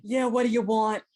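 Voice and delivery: loud, gruff New York poker player; New York accent. Acted. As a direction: push it out like a New York card shark. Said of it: "poker voice, like, New York accent".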